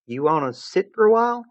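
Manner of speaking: put-on gentle high girl voice; Jade voice.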